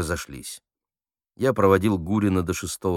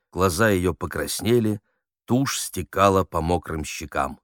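usual speaking voice

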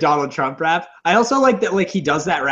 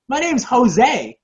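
put-on higher, slow child's reply; kid; 9 semitones higher, much slower than usual. Child actor introduces himself to Trump, imitated kid voice. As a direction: play an eager kid giving his name. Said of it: "And the kid's like".

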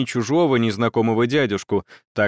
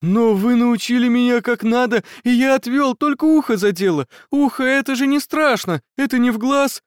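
shaken; 10 semitones higher, 3 dB louder than usual. Acted. Wounded boy babbling after attack.

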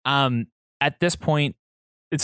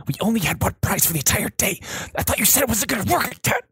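hurried worried worker; 8 semitones higher, faster than usual. Acted. Staff arguing overheard.

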